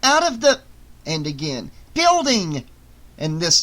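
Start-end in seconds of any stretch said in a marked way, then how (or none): 0.0-0.6 loud, high, straining command; urgent shout. A narrator's reading. Missus Poole tugging at Andy, loud high urging. Woman tugs boy towards exit. 1.9-2.6 high, loud burst of effort; urgent shout. A narrator's reading. Missus Poole tugging Andy again, loud high shout. Woman tugs boy again.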